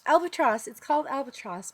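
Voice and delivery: high, loud burst of sudden recall, then easing; cute-sounding. Real, not acted. Makeup vlogger suddenly remembers the highlighter's name, cute excited blurt. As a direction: blurt it out cutely as it comes back. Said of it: "how cute did I just sound".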